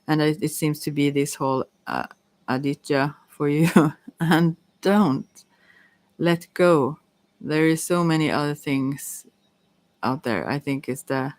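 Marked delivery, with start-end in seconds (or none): none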